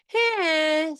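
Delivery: "Hey" high and loud, all vowel; vowel-heavy child word. Retold or imitated. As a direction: pitch "Hey" up and hold the vowel. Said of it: "they'll say".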